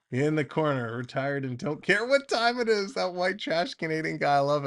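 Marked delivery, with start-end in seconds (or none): none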